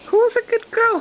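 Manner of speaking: squeaky high coo. Real, not acted. Mom praising the baby after a spoonful, high coo. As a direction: praise her like a delighted parent.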